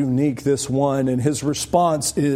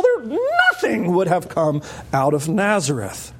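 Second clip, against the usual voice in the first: mock-scornful, higher and building to a half-laughing shout on "nothing delightful"; scoffing at Nazareth; 5 semitones higher than usual. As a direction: sneer at the town, half laughing. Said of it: "He's already slammed Nazareth".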